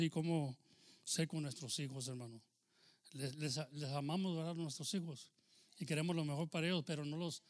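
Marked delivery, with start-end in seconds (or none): none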